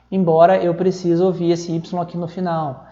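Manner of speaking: ordinary talk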